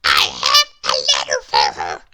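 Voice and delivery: very low, loud little man voice; Mister Small asking. Acted. Lost Mister Small asks for help, very low voice. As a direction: ask politely in a deep voice. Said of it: "said Mister Small".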